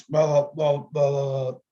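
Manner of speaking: a hint of grandpa grumbling; mumbling old grandfather. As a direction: mumble it like an old man nobody can understand.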